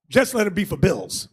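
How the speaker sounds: ordinary talk, nothing marked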